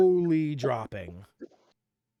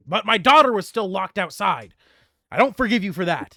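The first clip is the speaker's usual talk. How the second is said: indignant king; 5 semitones higher, 8 dB louder than usual. Acted. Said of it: "King Dongle".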